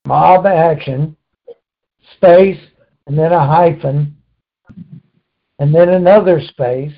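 slow, spelled-out dictation; dictating slowly. Shown as a demonstration. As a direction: spell it out slowly for note-taking. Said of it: "write down mob".

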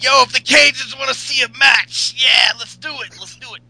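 excited mock wrestler, high and loud; Ryback. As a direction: holler like a pumped-up meathead, stretching the last words.

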